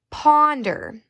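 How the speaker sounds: ordinary delivery, nothing marked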